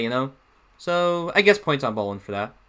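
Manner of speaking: ordinary talk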